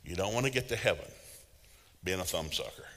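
ordinary talk, nothing marked

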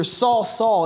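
Saul, Saul called out loud and high; stern call. Shown as a demonstration. Pastor showing how Jesus called out to Saul, stern and loud. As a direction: call the name sharply to grab his attention. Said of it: "it's more like he's yelling loudly or sternly".

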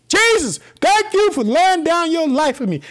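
high, ringing shout of thanks; shouted praise. Real, not acted. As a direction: shout it out to heaven, unashamed. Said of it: "I shout it".

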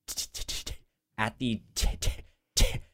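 normal talking voice

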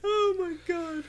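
high, slow, shocked wail; a squealing oh my God; mock horrified cry. Real, not acted. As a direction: recoil in disgust. Disgusted reaction.

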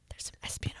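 hushed mock-spy whisper; playful spooky aside; conspiratorial whisper. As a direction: whisper it like a secret. Playing up the spooky story.

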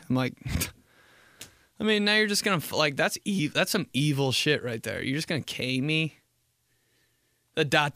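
usual speaking voice

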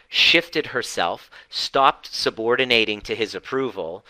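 ordinary talk

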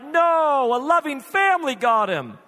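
high, loud mock wail; hammed-up childish insistence; imitated kids' protest. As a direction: protest like kids in denial. Kids protest the coyote story.

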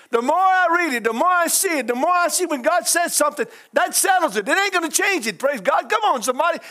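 fervent church preaching; preaching at full force. Acted. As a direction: lift it like a revival sermon. Preaching builds.

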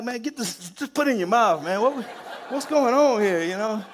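high, loud, laughing disbelief. Retold or imitated. Sergeant to shaking troop about the cigarette, laughing. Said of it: "I'm laughing".

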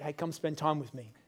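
gently, like a quiet nudge inside; small voice. Retold or imitated. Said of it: "The voice of the Holy Spirit".

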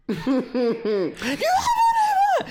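very slow, high laughing shout; laughing mock wrestler. Host mocking the wrestler while laughing, high and slow.

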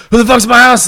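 mock startled shout; alarmed shout. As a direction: yell in surprise at a noise in the house. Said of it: "I hear noise and I'm like".

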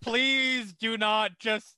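drawn-out, exasperated plea; slow, insistent begging; emphatic plea. Real, not acted. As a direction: stretch out each word to beg them off. Begging joker not to do accent.

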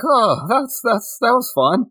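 high-pitched, airy mock nonchalance; breezy nonchalance. Unscathed fighter dusting himself off after the attack, breezy nonchalance. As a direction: play it as shrugging off a hit.

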